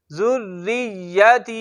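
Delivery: जुर्रियती slow, with the rr held and the r thin. Shown as a demonstration. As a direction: stretch the rr in जुर्रियती, long ता. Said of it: "रा को हमने बारीक पढ़ना है".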